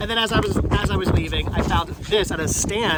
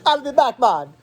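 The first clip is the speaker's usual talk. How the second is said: put-on laughing Jamaican accent, pitched up, on I'll be back, man; Jamaican Terminator; 6 semitones higher than usual. Joking with a friend, laughing Jamaican accent. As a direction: toss off a giggly Jamaican-accented Terminator line. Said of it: "like a Jamaican Terminator".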